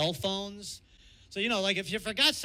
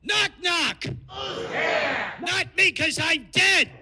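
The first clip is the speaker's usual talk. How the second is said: shouted joke; 8 semitones higher, 6 dB louder, slower than usual. Retold or imitated. Continuing the comic impression.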